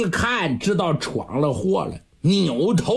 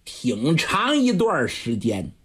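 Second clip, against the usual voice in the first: ordinary delivery, nothing marked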